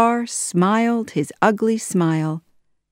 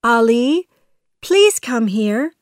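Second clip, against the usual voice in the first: high, louder imitation of a princess; calling from afar; 6 semitones higher, 5 dB louder than usual. A narrator's reading. Parrot faking the princess's voice, high calling. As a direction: call out sweetly to lure him. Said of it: "said a voice from the garden".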